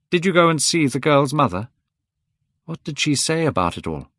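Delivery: a hint of an anxious older friend; lightly voiced worried Basil. Basil pressing the young Dorian with worry.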